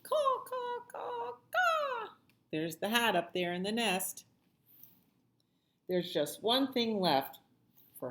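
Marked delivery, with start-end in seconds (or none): 0.0-2.1 very high cawing crow voice. Acted. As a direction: squawk it like a cheeky crow. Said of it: "the crow only replies".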